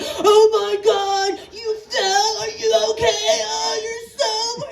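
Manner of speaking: high, drawn-out mock panic; overdone wailing concern; fussing parent. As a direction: fuss hysterically over a fallen child. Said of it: "and the parents are like".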